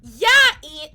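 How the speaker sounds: high, loud, teasing call; loud, high teasing yeah Ian. Real, not acted. Calling out moderator.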